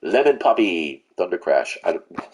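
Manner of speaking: ordinary talk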